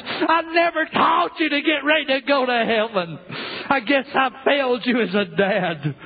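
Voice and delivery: put-on a broken father pleading with his children; sorrowful lament of a damned father; grieving father in hell. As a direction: speak as a dad lost forever, full of regret.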